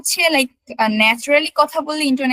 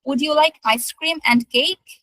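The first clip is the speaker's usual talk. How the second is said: "ice cream" and "cake" both rising; double rise intonation; 2 semitones higher, much slower than usual. Shown as a demonstration. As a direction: offer both, lifting "cake" at the end. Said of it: "দুইটাই rise করে".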